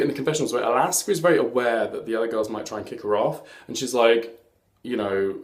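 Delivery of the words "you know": ordinary talk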